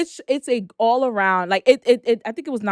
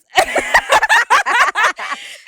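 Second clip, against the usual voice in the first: loud high cackle; high cackling laugh; 4 dB louder than usual. Real, not acted. Remembering the frenzy of the episode, cackling loudly. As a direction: burst into a long high laugh.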